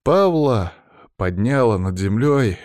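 ordinary delivery

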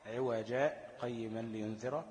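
slow recitation, brief silent stop after عوجا without breath; short stop after عوجا. Shown as a demonstration. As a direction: pause on عوجا, then go on to قيما. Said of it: "وله السكت كذلك على ألف عوجا". (سكتة لطيفة على عوجا بلا نفس)

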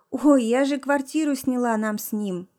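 usual speaking voice

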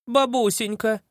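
put-on high teasing girl. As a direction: coo it sweetly.

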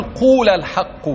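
قول drawn slowly with a long u before الحق; teacher showing the Hudhayl past-tense قول; long-vowel قول. Shown as a demonstration. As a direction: lean on قول and stretch its long u. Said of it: "ده مش فعل أمر".

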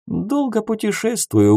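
high-pitched, lightly evasive reply. A narrator's reading. Traveller dodging a companion's question, lightly evasive. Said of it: "уклончиво отозвался я".

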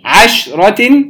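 عشرة split after a vowelless ش; شين ساكنة. Shown as a demonstration. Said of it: "ولم نحرك الشين". (عشرة بسكون الشين)

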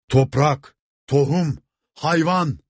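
high, rising crowd chant; shouted demand. A narrator's reading. Crowd at the gate demands land, shouting. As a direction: call out each demand as a cry. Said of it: "diye haykırarak".

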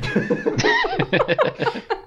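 high-pitched giggling laugh; burst of laughter. Real, not acted. Host joking about a father's accent, laughing. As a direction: crack up at your own remark. Cracks up after own joke.

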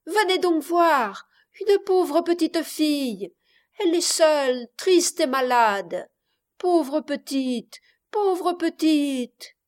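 put-on high, pitying little girl; child crying out. A narrator's reading. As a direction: call out loudly, then draw out the pity slowly. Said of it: "cria tout à coup l'enfant". (fillette qui s'apitoie, voix aiguë)

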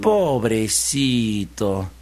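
very slow, stretched-out sympathy; pitying piglet. Acted. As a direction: draw the word out with pity. Piglet pities the exhausted fox. (lástima, alargando mucho la palabra)

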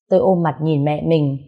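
plain reading voice

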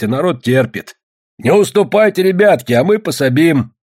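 high, insistent complaining speech; agitating villager. A narrator's reading. Villager urges the others not to yield, agitated.

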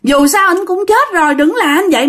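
high-pitched acted cry of a widow; wailing protest. A narrator's reading. Wife begs in-laws not to open the coffin, wailing protest. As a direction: plead in distress, voice held high. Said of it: "la làng lên quá".

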